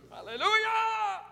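high, ringing hallelujah; real outburst of praise, pitched high; praise cry. Real, not acted. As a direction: cry it out to the congregation. Praise bursting out after prayer.

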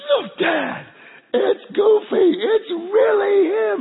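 put-on high, loud, drawn-out little-boy thrill; mock excited child. Boys spotting Goofy for Dad, hammed-up excitement. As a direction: imitate a kid squealing at a hero.

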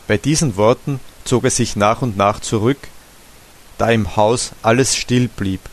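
ordinary delivery

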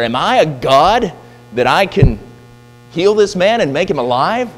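the king throws up his hands in protest; alarmed outburst. Retold or imitated.

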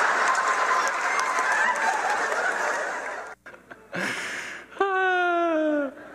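loud, long burst of real laughter; big real laugh. Real, not acted. Pastor laughing at played clip.

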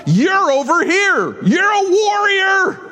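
high, slow, ringing shout; preaching shout. Real, not acted. Preacher to congregation, rising into a high slow shout. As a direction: proclaim it like a battle cry.

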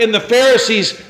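ordinary talk

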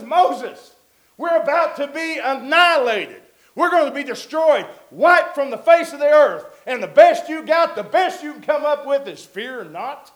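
loud, high, desperate complaint to Moses; frightened crowd yelling at their leader; panicked protest. Retold or imitated. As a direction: shout it like the army is closing in. People protest facing annihilation.

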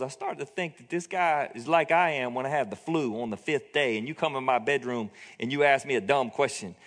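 usual speaking voice

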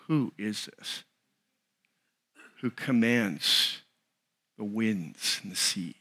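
hushed, slow, low awe; very soft, halting fearful wonder; scared to death. Retold or imitated. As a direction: imitate frightened men murmuring to one another. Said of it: "Scared to death".

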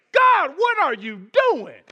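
loud, high anguished plea; crying out to God. Acted. Moses in despair, loudly pleading with God. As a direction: throw the question up at heaven.

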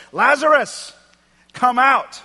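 big, high-pitched shout, each phrase set apart; loud commanding call. Acted. Jesus calling dead Lazarus out of the tomb, loud shout. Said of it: "he said with a loud voice".

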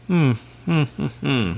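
pondering dragon hums; thoughtful. A narrator's reading. Dragon not relishing the job, thoughtful. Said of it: "said the dragon thoughtfully".

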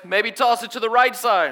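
high, loud holler; calling out advice. Retold or imitated. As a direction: shout a suggestion across the water. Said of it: "the guy starts giving advice".

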